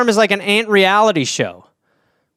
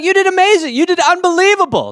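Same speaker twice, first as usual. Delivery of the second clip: much higher, overeager praise; gushing judge; 10 semitones higher than usual. Acted. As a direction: gush like a pushover judge. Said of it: "I'd be like".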